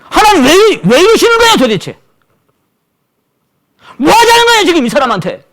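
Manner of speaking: high, loud indignant protest; 높고 크게 분노하며 따지듯; exasperated outrage. Retold or imitated. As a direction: cry out in frustrated disbelief. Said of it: "막 화가 나더라고요".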